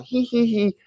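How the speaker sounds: high mincing giggle mocking the liberals; a mocking, high, girly tee-hee; a high, sneering giggle.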